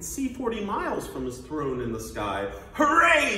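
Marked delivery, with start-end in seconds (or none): 2.7-3.4 high, loud triumphant cheer; shouting. Acted. Turtle king perched up so high, shouting. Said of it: "shouted Yertle".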